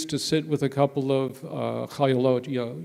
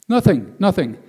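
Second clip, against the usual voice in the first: loud, higher, hasty brush-off; mock denial; 4 semitones higher, 9 dB louder than usual. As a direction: imitate someone insisting too hard. Acts out soldier denying tears.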